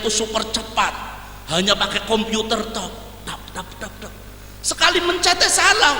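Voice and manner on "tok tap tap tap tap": whispered tok tap tap like keys; soft tapping noise. Retold or imitated. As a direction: tap out keystrokes under the breath. Imitating computer keys.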